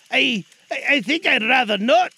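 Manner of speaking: put-on stammering high old man; hesitant Scrooge. Scrooge backing away from the offer, stammering. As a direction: stumble over the refusal.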